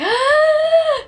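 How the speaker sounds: a sharp, high, loud gasp of realisation. Retold or imitated. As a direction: gasp as it all clicks. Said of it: "and I was like".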